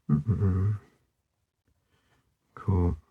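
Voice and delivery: ordinary delivery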